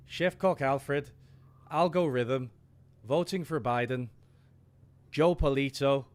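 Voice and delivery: over-the-top Irish brogue for a live chat shout-out; hammed-up Irish accent. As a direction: read the list like a flamboyant Irish host. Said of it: "in your most egregious, flamboyant Irish accent".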